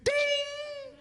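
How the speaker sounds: high squeal of delight then a ding; mock the wife's high ahh; wife gasping at a rose. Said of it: "she'll go".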